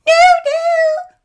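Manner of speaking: very high, very loud squeal; little goat squealing at the troll. A narrator's reading. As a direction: shriek like a panicked kid goat. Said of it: "squealed the little billy goat".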